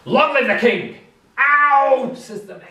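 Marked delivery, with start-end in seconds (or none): 0.1-1.0 soldiers shouting. Acted. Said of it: "they all shout". 1.4-2.1 high, loud yelp of pain. Acted. Mayor yelps in pain at the soldiers' shout.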